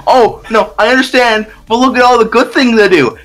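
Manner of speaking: high, mock-innocent excuse; whiny YouTuber voice. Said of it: "He's like".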